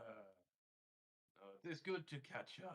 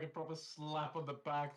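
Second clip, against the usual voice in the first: ordinary talk, nothing marked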